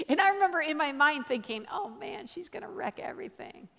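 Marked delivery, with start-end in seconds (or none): 1.7-3.7 high, hushed panicky thought; inner dread. Retold or imitated. Speaker silently fretting as friend nears the dealer, dread. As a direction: think it in a soft, high worried voice.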